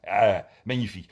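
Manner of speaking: ordinary talk, nothing marked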